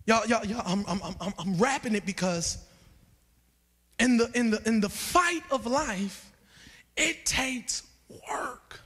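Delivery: soft, low, halting sermon close; subdued preaching. Acted. Preacher wraps up on marriages under attack, hushed preaching. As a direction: bring it right down, low and weighted.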